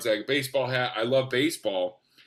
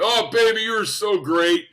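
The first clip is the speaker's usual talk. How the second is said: high, loud imitated flattery; gushing praise; 6 semitones higher, 7 dB louder than usual. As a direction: gush like a showbiz veteran.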